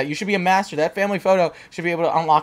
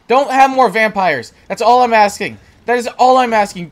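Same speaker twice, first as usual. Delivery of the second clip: pleading; 4 semitones higher, 6 dB louder than usual. Real, not acted. Begging game not to spawn vampires.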